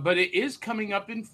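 ordinary delivery

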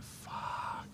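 breathless whispered swear; awed whisper. Retold or imitated. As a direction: let it out as a stunned, voiceless whisper. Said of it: "And I was like".

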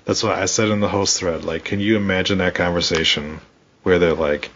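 ordinary talk, nothing marked

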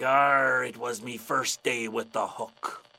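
slow, gruff sea-dog reveal; deadpan pirate punchline. Acted. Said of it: "the pirate's like".

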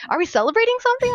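high, quick, perky inquiry; quoting someone keen to join in; imitated eager question. Server asking the table, eagerly curious.

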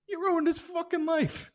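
high-pitched indignant protest; indignant outburst. Acted. Outraged retort to documentary.

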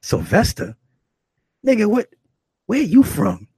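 loud, high, incredulous mockery; clowning him. Rough woman mocking visitor named Sylvester, loud clowning. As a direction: imitate her scoffing at the name. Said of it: "She was clowning me".

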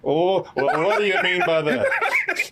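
laughing through a slow mock question; laughing retort. Retelling his reply to the woman, laughing. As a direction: chuckle and drag it out slowly.

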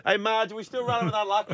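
lightly voiced newsroom staffer; chatty high newsroom banter; newsroom colleague voice. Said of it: "imagine them putting the auto cue down".

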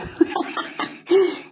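high, airy laughing burst; breathy laugh. A narrator's reading. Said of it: "Adriana laughed like only she can".

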